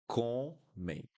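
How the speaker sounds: comem stretched syllable by syllable; drawn-out demonstration. Shown as a demonstration. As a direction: draw out comem to show the open ending. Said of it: "let me make it".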